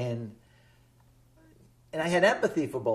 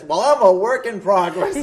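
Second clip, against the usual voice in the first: louder, higher imitated comeback; colleague's retort; 5 semitones higher, 11 dB louder than usual. Retelling colleague's comeback to a laughing host, loud mock retort. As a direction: fire back loudly, quoting her for laughs. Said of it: "and she says".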